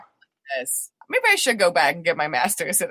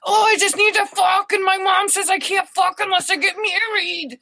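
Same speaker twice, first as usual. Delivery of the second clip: high mock teen whine; mocking horny teenager; 11 semitones higher than usual. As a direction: squeal it like a dumb teen. Friends quoted as horny teens.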